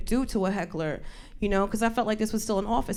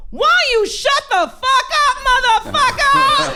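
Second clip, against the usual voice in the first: high, drawn-out furious scream; full-volume, high-pitched motherly outrage; enraged mom yelling; 10 dB louder, slower than usual. Retold or imitated. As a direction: scream like a mother tearing into a heckler. Retelling mom exploding at the heckler.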